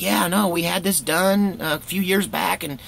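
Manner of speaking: high, chatty uncle explaining results; uncle's voice. Retold or imitated. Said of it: "he's like".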